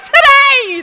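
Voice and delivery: high, loud joyful "Today!"; proudly bursting with the news; thrilled reply. Retold or imitated. Answering the excited women.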